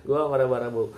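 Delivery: chuckling through "Gua marah-marah mulu"; amused laughing retake; laughing repeat. Real, not acted. Repeats own line laughing.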